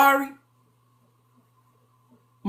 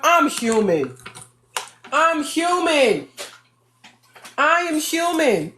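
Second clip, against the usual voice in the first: mocking excuse; 4 semitones higher, slower than usual. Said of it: "isn't that what people say".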